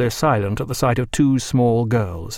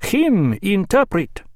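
put-on high, slow broken-English Spanish accent; pointing to his interpreter in a Spanish accent; slow foreign accent, broken English; 8 semitones higher, slower than usual. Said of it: "in a foreign accent".

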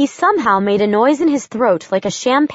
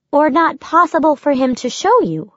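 lightly raised, brisk woman's voice; young woman voice; 6 semitones higher than usual. Acted. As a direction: voice Josie a little higher. Josie's dialogue voiced higher.

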